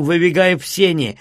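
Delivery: ordinary delivery, nothing marked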